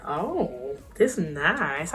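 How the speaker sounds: soft, low, blissed-out murmur; quiet, contented ease; relaxed. Retold or imitated. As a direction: settle in as the pain fades. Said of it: "I was so relaxed".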